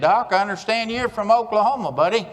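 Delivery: lightly voiced cocky Texan needling him; Texan superintendent. Texas superintendent teasing the Oklahoman speaker, mock Texan voice.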